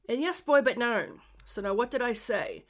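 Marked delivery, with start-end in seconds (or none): 0.2-1.2 louder, raised, amateur Newfoundland accent; Newfie accent. Shown as a demonstration. Podcaster demonstrating local phrases for listeners, attempted Newfie accent. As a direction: punch out a Newfie phrase, not quite native. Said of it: "trying to do a Newfie accent".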